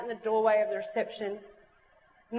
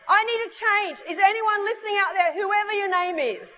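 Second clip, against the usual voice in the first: calling out; 7 semitones higher than usual. Retold or imitated. Girl calling out to someone whose name she doesn't know, high, pleading call. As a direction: call out to someone unknown, pitched well up. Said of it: "but I just call out".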